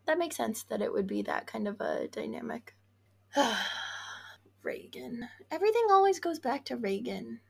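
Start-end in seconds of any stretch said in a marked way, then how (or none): 3.3-4.1 long breathy sigh; weary sigh. Real, not acted. Co-host reacting to Reagan coming up, weary sigh. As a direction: let out an exasperated sigh.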